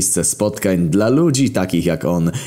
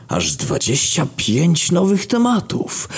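amazed; 11 semitones higher, slower than usual. Acted. Stunned at the number of posts.

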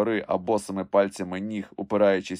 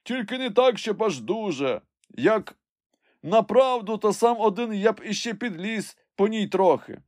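high-pitched hedging call; servant calling down; 12 semitones higher than usual. A narrator's reading. Climber says he might venture further alone, high call. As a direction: call down a qualified answer. Jupiter answers from the tree.

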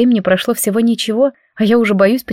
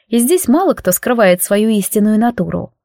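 ordinary delivery, nothing marked

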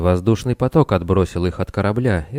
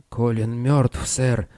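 put-on lisping old voice, higher pitched; 4 semitones higher, slower than usual. A narrator's reading. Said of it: "прошепелявил голос в трубке".

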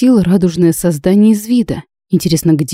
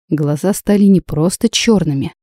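plain reading voice

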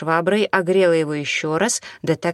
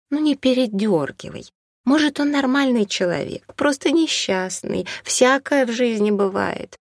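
higher-pitched, pleading woman's voice; conciliatory woman; 8 semitones higher than usual. A narrator's reading. Woman defends a stranger to her friend, conciliatory.

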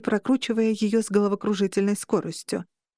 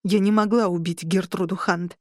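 ordinary delivery, nothing marked

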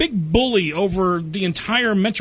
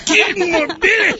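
much higher, strained Scottish-style Fat Bastard voice; Fat Bastard quote; 11 semitones higher, 3 dB louder than usual. Retold or imitated. Host quotes his favourite movie line in a high Scottish voice. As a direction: belt out Get in my belly like Fat Bastard. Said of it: "He always says that".

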